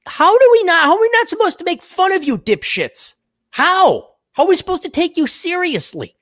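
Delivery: high, loud incredulous outburst; exasperated rant. Real, not acted. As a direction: rail at them in disbelief. Mocking rant at astrology fans.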